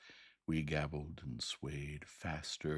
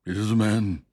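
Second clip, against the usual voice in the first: louder, shouted ape man cry; loud gabble; 6 semitones higher, 17 dB louder than usual. A narrator's reading. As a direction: yell it out in excitement. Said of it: "gabbled my conductor".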